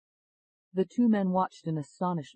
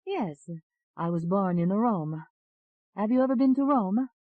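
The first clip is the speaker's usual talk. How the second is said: put-on Italian accent; 6 semitones higher, 5 dB louder than usual. A narrator's reading. Second alter telling of Rome, high Italian accent. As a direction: chat with a lilting Italian accent, pitched up. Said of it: "You have an Italian accent".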